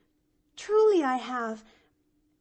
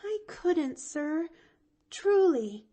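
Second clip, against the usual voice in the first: faltering; 10 semitones higher, 3 dB louder, slower than usual. A narrator's reading. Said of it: "faltered Pollyanna".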